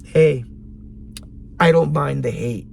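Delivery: normal talking voice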